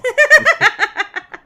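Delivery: loud high-pitched giggle. Acted. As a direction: squeal of laughter. Acting out phone call.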